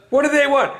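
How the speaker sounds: Larry yelling back. Retold or imitated. Larry shouting back, asking what the caller wants. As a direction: call out grumpily from another room.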